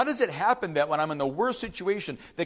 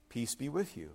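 much softer, low hushed blessing; gentle Jesus; 7 semitones lower, 11 dB quieter than usual. Acted. Jesus greeting the disciples, hushed and tender. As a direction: breathe it out tenderly. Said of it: "and he says".

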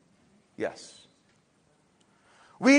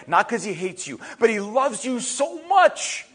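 normal talking voice

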